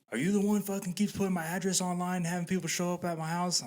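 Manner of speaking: high-pitched accusing tirade; imitated angry homeowner. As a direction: confront the culprit in one indignant run. Acting homeowner confronting the kid.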